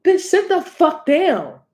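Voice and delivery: high, slow scolding; exasperated telling-off. Acted. Reader ordering a Leo to sit, high and exasperated. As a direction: put a show-off in their place, stretching the words. Mock telling-off of attention seeker.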